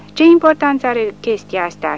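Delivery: offended chaplain snapping at the soldiers; disgusted spit. A narrator's reading. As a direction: spit the question out with contempt. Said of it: "scuipă capelanul dezgustat".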